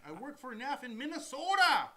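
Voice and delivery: put-on high, loud, drawn-out Minnesota accent; stretched, hammed-up Minnesota vowels. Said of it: "Is that your Minnesota accent?".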